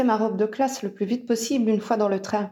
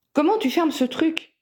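higher-pitched, mildly irritated grumble; grumbling; 7 semitones higher than usual. A narrator's reading. Owen struggles with the zipper, mildly grumbling. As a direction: grumble it lightly while fighting with a fastening. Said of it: "grogna-t-il en bataillant".

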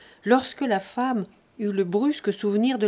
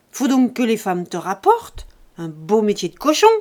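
furious insults; 3 semitones higher, 7 dB louder than usual. A narrator's reading. Lise insulting Jean over the inheritance, furious shout. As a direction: spit the insults at him.

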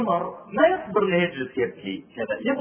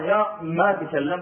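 ordinary talk

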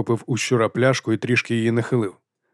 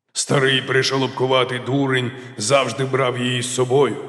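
plain reading voice

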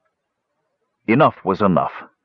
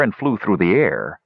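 ordinary delivery

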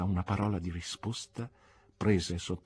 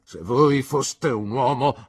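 Marston's stiff reply; 4 semitones higher, 8 dB louder, slower than usual. Acted. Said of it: "replicò mister Marston".

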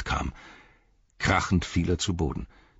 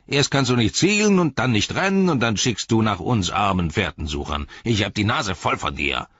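higher-pitched angry orc rant; fed-up orc tracker; 6 semitones higher, 6 dB louder than usual. Acted. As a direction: berate him as a fed-up orc.

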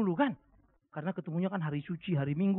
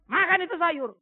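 raised, louder firm command; stern order; 9 semitones higher, 7 dB louder than usual. Retold or imitated. Father ordering his child to eat vegetables, stern and loud. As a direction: order the kid to eat, no arguing.

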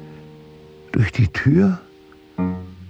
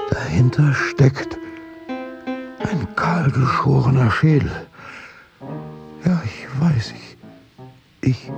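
feverish realisation; 6 semitones higher than usual. Acted. Prosecutor seeing through the disguise, feverish inner monologue. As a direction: push each discovery out with mounting breath. Mounting realisation, louder and higher.

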